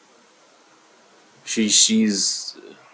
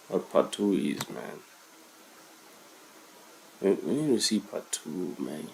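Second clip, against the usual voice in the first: much softer, dazed; stunned hush; 3 semitones lower, 13 dB quieter than usual. Real, not acted. Reactor still reeling from the reveal, stunned hush. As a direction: say it barely aloud, shaken.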